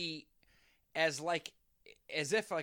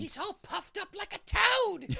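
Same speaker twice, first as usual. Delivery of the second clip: high, loud cartoon voice; mock puffed-up voice; 10 dB louder than usual. To the co-host, describing mumps-swollen Hobbs in a comic voice. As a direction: puff the words up like a swollen toad.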